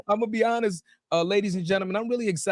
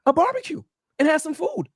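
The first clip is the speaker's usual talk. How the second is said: higher-pitched, put-on jealous complaint; mock hurt; 7 semitones higher than usual. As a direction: play slighted, with pauses between the grievances. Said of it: "I'm a little bit sad".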